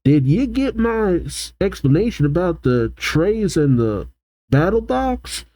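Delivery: high-pitched pretend yelling at normal volume; mock shout. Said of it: "Bill shouts".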